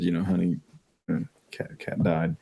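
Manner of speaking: soft, fading into a whisper on the bad news; hushed and careful, almost whispered; gentle hushed. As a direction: break it softly to a little girl. Breaking pet's death to daughter.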